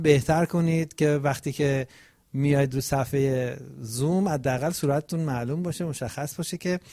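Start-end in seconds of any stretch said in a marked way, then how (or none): none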